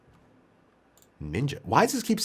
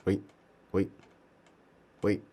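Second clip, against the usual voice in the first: ordinary delivery, nothing marked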